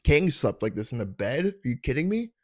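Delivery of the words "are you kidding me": pitched-up disbelieving question; incredulous. Real, not acted. Comic boasts about his bed, mock incredulous. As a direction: sell it like it's unbelievable luck.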